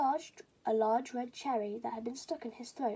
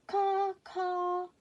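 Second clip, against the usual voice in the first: very high, loud caws; crow caws; 9 semitones higher, 5 dB louder than usual. Acted. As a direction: caw like a proud crow. Baby crow answering.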